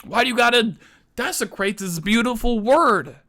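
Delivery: high, exasperated appeal; indignant, voice pitched high; anguished protest. Acted. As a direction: wail it in mock heartbreak. Pleading over a ruined word.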